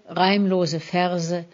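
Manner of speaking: normal talking voice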